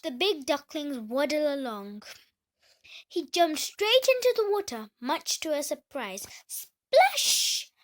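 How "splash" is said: high, loud splash sound. Acted. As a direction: make the splash of a duckling diving in.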